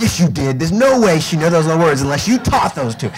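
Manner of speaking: imitated mother reaming out her son; mock scolding. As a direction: lay into him like an accusing mom. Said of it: "just reams them out".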